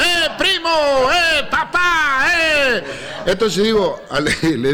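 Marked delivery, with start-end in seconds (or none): 0.0-2.8 high shouted calls from the crowd; crowd yelling. Retold or imitated. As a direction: yell like a wild festival fan. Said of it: "La gente enloquecida gritaba". (gritos de la gente enloquecida, muy agudos)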